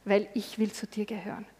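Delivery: a hushed, devoted whisper; whispered prayer. Acted. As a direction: whisper the last line of the prayer.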